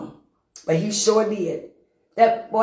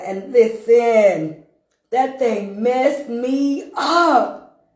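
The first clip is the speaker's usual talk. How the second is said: very slow, stretched; 3 semitones higher, 4 dB louder, much slower than usual. Real, not acted. Telling the church how badly it hit him, very slow. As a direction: drag every word out. Slow emphatic punch line.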